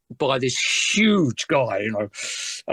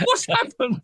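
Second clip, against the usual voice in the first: startled. Retold or imitated. Shocked at hail of drumsticks.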